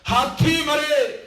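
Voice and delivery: measured verse with stretched words; poem recitation. Acted. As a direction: declaim it high and drawn out. Reciting the next couplet.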